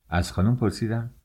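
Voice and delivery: usual speaking voice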